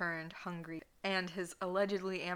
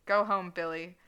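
a louder barked order; screaming; 8 dB louder than usual. Acted. Bartender screams at [name], loud. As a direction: throw him out with your voice. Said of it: "the bartender screamed".

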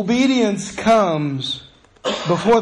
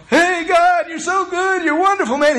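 high, loud, overjoyed praise; high excited shout of thanks to God; fired up; 12 semitones higher, 3 dB louder than usual. Retold or imitated. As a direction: burst out thanking God, thrilled. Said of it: "He was fired up".

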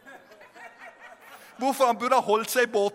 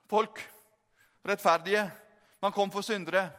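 normal talking voice

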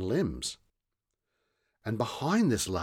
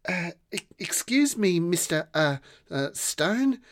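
put-on much higher, stammering young voice; hesitant girl; 9 semitones higher than usual. A narrator's reading. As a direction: stammer politely like a small girl. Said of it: "said Rosa".

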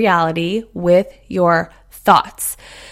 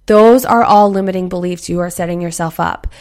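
ordinary delivery

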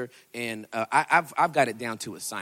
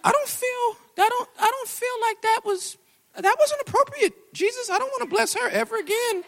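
complaining to God; 6 dB louder than usual. Retold or imitated. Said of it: "I was like".

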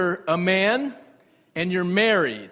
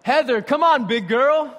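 loud, higher call to his wife; raised, punchy retold outburst; blurted call-out; 6 semitones higher, 5 dB louder than usual. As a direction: blurt it out loudly, imitating a TV chef. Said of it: "Someone else came in and just said".

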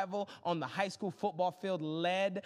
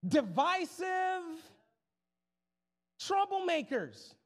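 exasperated scolding; 7 semitones higher, slower than usual. Real, not acted. Scolding congregation, drawn out.

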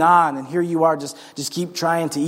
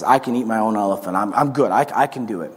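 mock prideful; 8 semitones lower than usual. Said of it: "you naturally push back against this".

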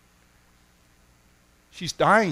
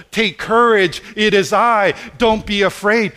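raised, louder declaration; ringing proclamation; 4 semitones higher, 6 dB louder than usual. Acted. Preacher proclaiming Jesus' words to the congregation, ringing out. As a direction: proclaim it to lift the room. Preacher's repeated key phrase, rising.

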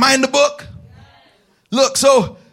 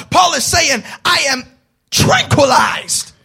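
full-force preaching; 2 semitones higher, 4 dB louder than usual. Acted. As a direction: preach it out to the congregation. Preaching climax.

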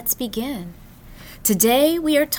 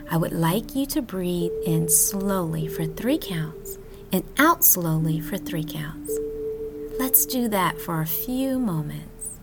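whispered breathing guide; soft spa whisper; 3 semitones lower, 4 dB quieter than usual. Acted.

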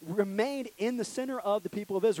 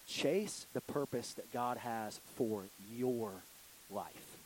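slow and much softer, low and deliberate; solemn hushed emphasis; 6 semitones lower, 9 dB quieter, much slower than usual. Shown as a demonstration. The preacher reads his all-caps line to the youth, slow and soft. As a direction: lean in and lay it down quietly. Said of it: "I wrote in all caps".

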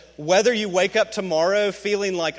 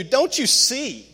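high, loud, drawn-out plea; urgent appeal; 7 semitones higher, 5 dB louder, slower than usual. Acted. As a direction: plead it like an exasperated preacher. Acting out Paul's urgent appeal.

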